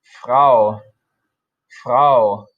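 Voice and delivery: careful German Frau; German model word. Shown as a demonstration.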